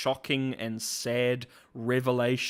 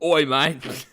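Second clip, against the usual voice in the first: put-on Australian accent; 3 semitones higher, 8 dB louder than usual. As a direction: put on a broad Aussie voice. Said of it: "oh, he's Australian".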